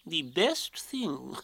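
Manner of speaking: very slow, sage owl voice; drawn-out pompous sage, trailing into a soft chuckle; wise. Acted. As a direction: pronounce it as great wisdom. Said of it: "said Owl wisely".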